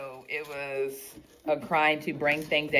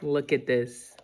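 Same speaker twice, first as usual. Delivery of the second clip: usual speaking voice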